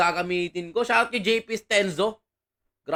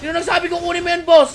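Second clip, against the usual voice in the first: high, quick raised-voice protest; scolding; 8 semitones higher, 6 dB louder than usual. Real, not acted. Streamer asking the boss who said to take it, scolding. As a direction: raise your voice and demand an answer. Repeats his scolding to teammate.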